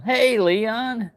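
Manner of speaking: bright high greeting to a viewer; calling out. Real, not acted. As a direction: hail a friend from afar.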